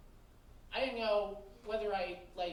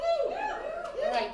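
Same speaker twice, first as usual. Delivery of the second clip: surprised; 4 dB louder than usual. Real, not acted. Reacting to crowd woo.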